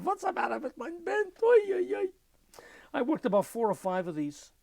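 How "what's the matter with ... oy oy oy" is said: high mock Yiddish-accented complaint; imitating an old bandleader; accented bandleader yell. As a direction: whine it like the flustered old leader. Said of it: "Perrotin is yelling out".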